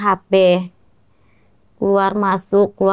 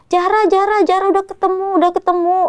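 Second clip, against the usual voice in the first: retold scream; 9 semitones higher than usual. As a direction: call her name out high, half-reenacting the scream. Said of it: "Terus menjerit lah". (teriakan tinggi menirukan orang yang menemukan Zahra)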